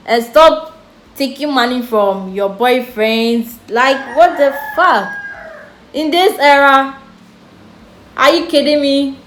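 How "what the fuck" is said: normal talking voice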